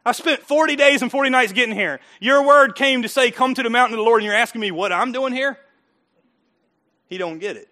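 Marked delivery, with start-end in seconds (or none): 0.0-5.6 higher, louder exasperated protest; indignant Elijah. Retold or imitated. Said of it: "don't you think Elijah's going".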